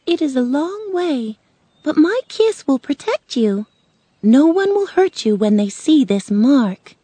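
high storybook witch, firm promise; reassuring Good Witch. Acted. Good Witch promises Dorothy her kiss will protect her, reassuring.

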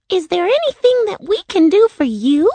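very high little-creature voice; eager Winkie. A narrator's reading. Winkie asking Dorothy how to help, high and eager. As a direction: offer help in a high put-on voice. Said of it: "one of the Winkies asked Dorothy".

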